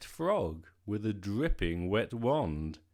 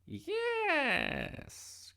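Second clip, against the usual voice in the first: very high, drawn-out witch's yes; storybook witch's high cry of welcome. A narrator's reading. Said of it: "cried the witch".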